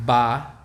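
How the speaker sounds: ba with an open, bright ah. Shown as a demonstration. The teacher shows the open A in ba. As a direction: open the mouth wide on ba. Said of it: "Ba is the open sound".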